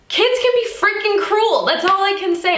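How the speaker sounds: high, emotional outburst while holding back tears; upset and tearful, pitch jumping up; fighting back tears. Real, not acted. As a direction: push the words out high, close to crying. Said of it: "I'm not gonna cry".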